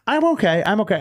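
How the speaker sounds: high-pitched mock-tearful reassurance; retold tearful reassurance. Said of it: "I'm, like, tearing".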